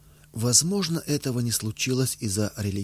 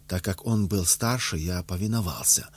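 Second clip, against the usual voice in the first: ordinary delivery, nothing marked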